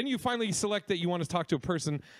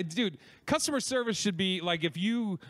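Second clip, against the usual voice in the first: ordinary delivery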